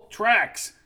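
high, forceful growling call. A narrator's reading. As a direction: bark the name with a growl. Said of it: "A forceful, growling, bare voice cracked".

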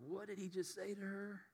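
acted stunned question, very quiet; shocked aside. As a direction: ask it under your breath, scandalized. Listener's shock voiced as aside.

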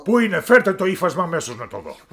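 high, loud royal tantrum; a spoiled king shouting his complaint; petulant shouting king; angry king. Acted. King demands the cloth.

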